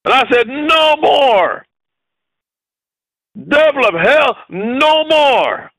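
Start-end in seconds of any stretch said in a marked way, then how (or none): 0.5-1.7 high, drawn-out cry of refusal; preacher's furious retold outcry; ballistic. Preacher retelling how he refused the devil, ballistic. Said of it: "I was ballistic". 3.4-5.7 high, fierce rebuke; ballistic. Retold or imitated. Preacher retelling his rebuke to the devil of hell, ballistic. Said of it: "I was ballistic".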